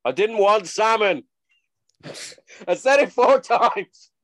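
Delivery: loud, high, drawn-out complaint; mock tantrum, stretching each word; hammed-up exasperated shout; angry outburst. Mimicking angry housemate outburst.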